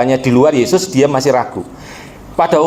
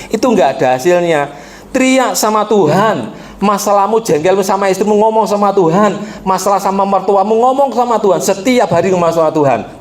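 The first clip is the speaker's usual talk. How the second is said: high, driving sermon voice; preaching at full force; 6 semitones higher than usual. Acted. Pastor warning the congregation against quarrelling at home, driving sermon. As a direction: hammer the command home.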